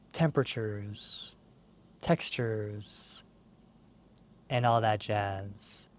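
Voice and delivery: soft, sinking meditation voice; guided meditation. Acted. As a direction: let each phrase settle softly into the pause. Guides the listener's senses.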